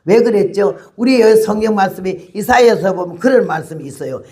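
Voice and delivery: ordinary delivery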